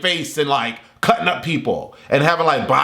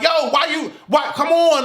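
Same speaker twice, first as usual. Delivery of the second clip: yelling at the TV; 9 semitones higher than usual. Retold or imitated. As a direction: shout come on at the TV like the hero can hear. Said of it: "I'm screaming at the T V like".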